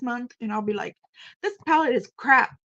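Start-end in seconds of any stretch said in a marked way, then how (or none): 1.4-2.6 loud, blunt mock verdict; exclaiming loudly. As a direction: declare it crap with gusto.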